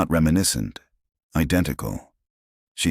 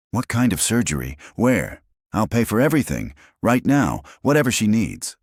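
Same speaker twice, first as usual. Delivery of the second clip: high, rushed and urgent; 6 semitones higher than usual. A narrator's reading. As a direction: rush to offer everything. Urgent offer of help.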